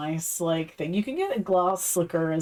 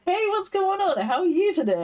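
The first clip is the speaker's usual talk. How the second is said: much higher, bright imitation of a chipper friend; cheery greeting; 10 semitones higher, faster than usual. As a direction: chirp it like an over-cheerful greeter. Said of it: "Maitland was like".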